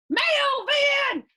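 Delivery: very high, loud shouted name; principal shouting. Acted. Principal shouting at Melvin who slammed into her, high and loud. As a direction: shout his name in shock. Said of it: "she shouts".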